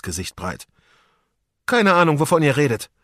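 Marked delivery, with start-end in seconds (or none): 1.7-2.9 put-on puzzled pirate boy. A narrator's reading. Federico puzzled.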